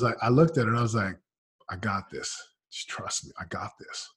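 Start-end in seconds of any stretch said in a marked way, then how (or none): 1.7-4.1 hushed, breathy promise; whispered reassurance. Retold or imitated. Husband reassuring his crying wife in a whisper. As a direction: whisper it to calm her.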